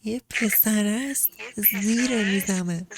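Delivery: every word spelled out slowly; patient slow repetition; slow repeat. Shown as a demonstration. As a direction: say it slowly so they finally get it. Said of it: "این چی بود".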